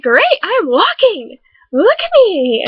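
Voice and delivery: slow, high cheer; mock excited. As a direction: show off with delight. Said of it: "I'm like".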